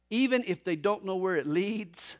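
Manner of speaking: usual speaking voice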